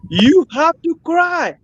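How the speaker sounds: slow, high and hammered, every word pressed; insistent demand. Real, not acted. Activist demanding leaders weep for dead children, slow and insistent. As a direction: drive it home like a final plea.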